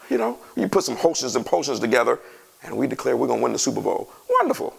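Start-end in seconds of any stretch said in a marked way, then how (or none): 4.3-4.7 high mocking sarcasm; mock them with a sarcastic wonderful. Preacher mocking witches' potions, sarcastic high wonderful.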